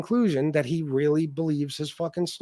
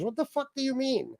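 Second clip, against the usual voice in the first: ordinary delivery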